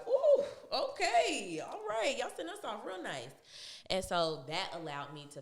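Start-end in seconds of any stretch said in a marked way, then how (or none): 0.1-3.3 high, loud whoop easing into pleased approval; delighted surprise. Retold or imitated. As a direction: react like an unexpectedly big check just arrived. Retold delight at generous severance check.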